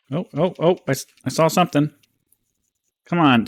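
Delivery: usual speaking voice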